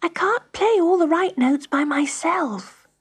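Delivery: put-on high child's plea; begging. A narrator's reading. Pat begging the grand piano for help, pleading high. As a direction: beg for help, pitched high. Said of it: "begged Pat".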